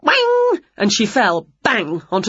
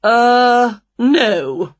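drawn-out, louder dismissive refusal; refusing aunt; 4 semitones higher, 5 dB louder than usual. A narrator's reading. Aunt turns down Winnie's demand, drawn-out refusal. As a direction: stretch a pause-word, then refuse.

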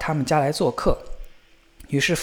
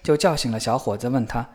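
ordinary delivery

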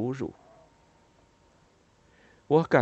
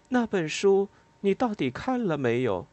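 pressing question; 9 semitones higher than usual. Acted. Narrator presses Lila on the phone.

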